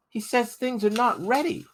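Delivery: put-on a boy sticking up for his friend; pleading boy's voice. Crispin defends Bear to John Ball, high boyish plea.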